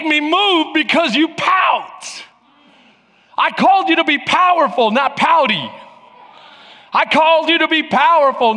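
high, forceful pulpit delivery; preaching. Real, not acted. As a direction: drive each point home to the congregation.

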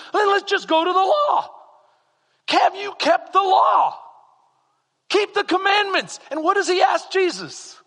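high, loud, driving sermon voice; full-force preaching. Acted. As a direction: preach it to the rafters.

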